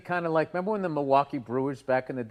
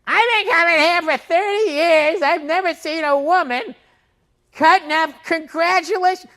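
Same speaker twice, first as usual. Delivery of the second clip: loud gushing; 12 semitones higher, 8 dB louder than usual. Retold or imitated. Older lady at fish counter gushing, high and loud. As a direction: gush so the whole store hears. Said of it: "all loud so everybody could hear".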